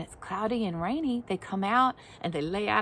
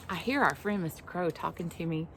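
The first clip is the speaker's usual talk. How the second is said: usual speaking voice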